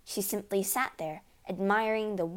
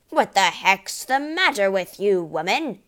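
loud, exasperated father's shout; angry shout; 8 dB louder than usual. A narrator's reading. Father shouting at wife over a mess, angry. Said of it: "he shouted".

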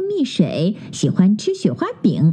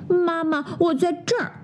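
higher, softer little cub; small cub voice; 8 semitones higher, 5 dB quieter than usual. Acted. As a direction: answer timidly from the floor. Cub answers from floor.

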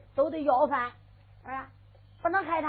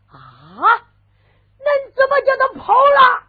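shocked outburst; 10 semitones higher, 14 dB louder, slower than usual. Acted. Master hears the mute ran off, shouting in shock. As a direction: explode in disbelief.

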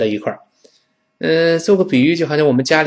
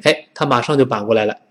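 ordinary talk, nothing marked

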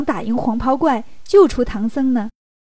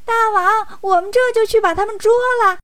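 high, quick little minion voice; excited imp reporting for duty, pitched way up; delighted little demon; 8 semitones higher than usual. Acted. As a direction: pipe up eagerly to the boss in a high voice. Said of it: "小妖们听了也高兴啊".

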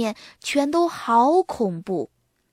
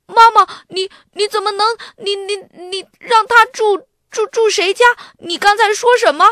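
much higher, louder, tripping over the words; stammering in shock; 11 semitones higher, 8 dB louder than usual. A narrator's reading. Arthur chasing Mom after the news, stammering in shock. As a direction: stutter with disbelief, voice jumping up. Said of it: "亚瑟说话都结巴了".

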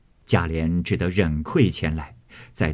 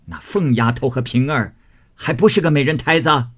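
much louder, higher furious grandmother; spitting scolding; 6 semitones higher, 6 dB louder than usual. A narrator's reading. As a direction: spit out contempt and rage at him. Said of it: "贾母又道".

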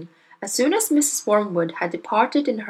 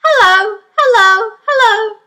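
parrot calling; 13 semitones higher, 9 dB louder than usual. Acted.

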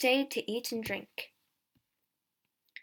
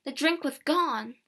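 usual speaking voice

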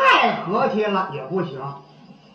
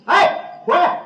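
higher-pitched call-back to someone walking off; 喊人回来; 8 semitones higher, slower than usual. Acted. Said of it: "往回喊那人". (高声把人喊回来)

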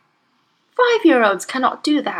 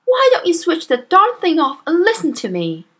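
losing patience; 7 semitones higher, 4 dB louder than usual. A narrator's reading. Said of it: "began to lose patience".

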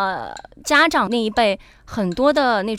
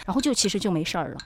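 normal talking voice

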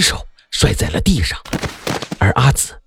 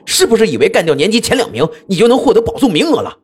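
furious roar; 10 semitones higher than usual. A narrator's reading. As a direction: roar the accusation in fury. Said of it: "冲着黑校服怪物怒吼着".

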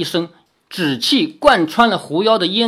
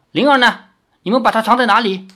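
higher, louder, rushing demand; urgent demand; 5 semitones higher, 4 dB louder, much faster than usual. A narrator's reading. Li Xiaoyao demands to know where Ling'er is, urgently. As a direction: press them hard for an answer.

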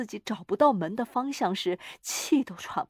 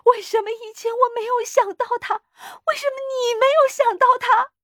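very high, loud, tearful wail; shrieking, overwrought reproach; hysterical; 14 semitones higher, 7 dB louder than usual. A narrator's reading. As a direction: wail the questions out, half crying. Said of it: "他歇斯底里地说".

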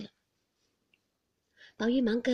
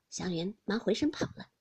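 usual speaking voice

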